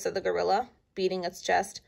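ordinary delivery, nothing marked

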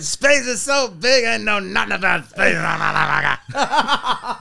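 slow, loud, stretched stand-up bellow; exaggerated comedian impression; Chris Rock. Shown as a demonstration. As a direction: drag it out, ending in a long strained holler. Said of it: "two impressions of Chris Rock".

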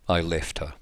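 usual speaking voice

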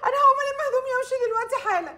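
crying. Acted. Bride threatening to leave, crying on the phone. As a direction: blurt it out through sobs. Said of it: "العياط مش هيجيب نتيجة".

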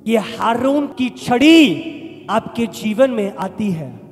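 ordinary talk

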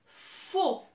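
"faux" with a lengthened f; syllable dictation. Shown as a demonstration. Teacher dictating a syllable to young pupils, drawn-out sounding-out. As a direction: stretch the opening f of "faux". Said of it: "le son F".

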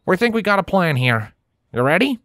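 high twangy country mare, upbeat; Applejack voice. A narrator's reading. Applejack rallying her friends, high country voice.

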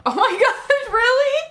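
laughing surprise. Real, not acted. Surprised by owning Jets.

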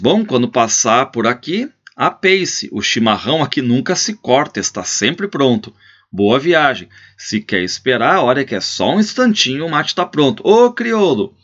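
rancher calling after a departing guest. Acted. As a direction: bid farewell, then holler for the mate. Farewell, then call after guest.

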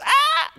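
sudden high yelp of panic; hammed-up frightened cry; mock panicked scream. Shown as a demonstration. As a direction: shriek like God losing control. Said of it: "God never goes".

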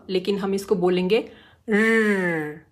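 र as a short growled r, no vowel; just the sound of र, not the full letter; r without vowel. Shown as a demonstration. Teacher showing the r phonics sound, र with no vowel. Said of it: "र पूरा नहीं बोलना है".

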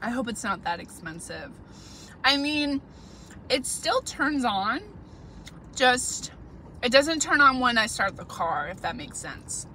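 speaking between bites of food; talking while chewing. Real, not acted. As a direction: mouth partly full. Seen 1: Bites salad at -1s, keeps eating, mouth moving while talking.

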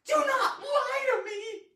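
high, loud, tearful scream. Acted. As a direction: scream it as a desperate accusation. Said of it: "She looked at me and screamed".

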